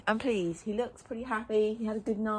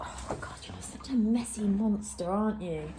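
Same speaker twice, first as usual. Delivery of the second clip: whispering; 3 semitones higher, 6 dB quieter than usual. Real, not acted. Whispering to horse in stable.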